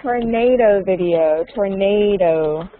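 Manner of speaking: "tornado" stretched out slowly; slow, clear modelling. Shown as a demonstration. Teacher models tornado for student, slow and drawn out. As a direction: say "tornado" slowly, drawing out the stressed middle syllable.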